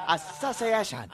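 exaggerated, drawn-out Japanese-accent imitation of "Association"; hammed-up mock Japanese accent. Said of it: "racist Japanese accent".

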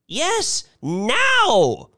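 very high, forceful outcry; insistent cry. A narrator's reading. Old woman pressing Mister Thurkle to show the rocket, crying out. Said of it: "cried Missus Bellows".

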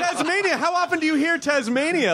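very high-pitched real disbelief; squeaky, amazed incredulity; incredulous. Real, not acted. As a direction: question it as if you can't believe the word. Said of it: "You sound incredulous".

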